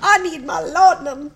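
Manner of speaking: high, loud, swooning frontier lady; Old West lady voice. Retold or imitated. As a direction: plead for it like a fainting lady. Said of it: "ladies from the Old West being".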